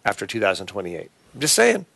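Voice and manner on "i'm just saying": playful aside. Real, not acted. Playful disclaimer.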